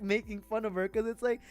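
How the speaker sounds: usual speaking voice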